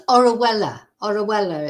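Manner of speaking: Orihuela said as "orra-WELL-a"; correct pronunciation. Shown as a demonstration. Host correcting the guest's attempt at the town's name, Orihuela pronounced "orra-WELL-a". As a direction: say Orihuela with a w sound and no y. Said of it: "it's pronounced".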